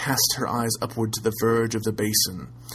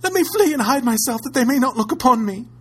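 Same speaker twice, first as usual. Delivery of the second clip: high, loud panicked cry; terrified cry; 8 dB louder than usual. A narrator's reading. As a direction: scream to escape in terror. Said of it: "she cried".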